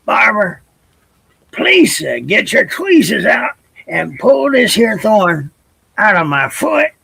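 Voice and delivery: high pleading little bird voice; begging. Acted. Hurt bird begging the barber, high pleading. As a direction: plead for help with a sore foot. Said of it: "he begged".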